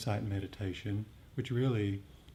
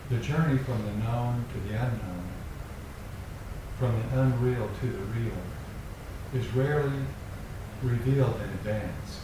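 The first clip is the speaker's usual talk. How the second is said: poem reading; 3 dB louder than usual. Acted. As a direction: let each image land, unhurried. Reads a poem aloud.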